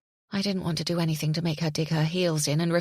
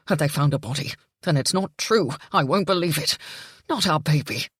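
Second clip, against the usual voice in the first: lower, louder desperate denial; a father refusing the news, desperate; anguished denial; 5 semitones lower, 4 dB louder than usual. A narrator's reading. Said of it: "he cried".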